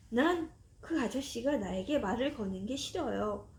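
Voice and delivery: ordinary delivery, nothing marked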